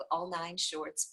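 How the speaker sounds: ordinary delivery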